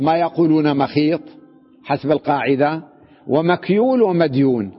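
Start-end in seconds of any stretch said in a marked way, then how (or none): none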